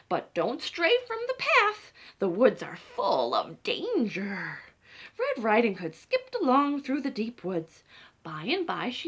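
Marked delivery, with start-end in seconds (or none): 0.1-4.6 slow, grave motherly warning. Acted. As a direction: warn her carefully, word by word.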